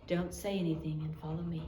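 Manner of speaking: hushed, low secret warning; whisper. A narrator's reading. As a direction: breathe it so nobody else hears. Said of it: "heard her whisper in perfect English".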